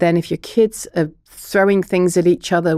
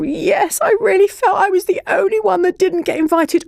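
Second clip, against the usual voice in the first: much higher, voicing a hurt child; child's voice; 11 semitones higher than usual. Shown as a demonstration. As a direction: play the upset child, high. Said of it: "And to".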